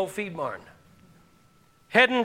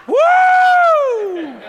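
high, loud whooping holler; wild whoop; whooping cry; 12 dB louder than usual. Retold or imitated. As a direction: let out an exasperated whoop. Retelling chaotic drive to church.

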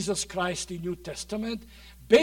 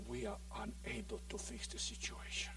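whispered, voiceless admission of defeat; 23 dB quieter than usual. Retold or imitated. Townspeople admitting defeat over Legion, in a hushed whisper. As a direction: breathe it out, giving up. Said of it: "they said".

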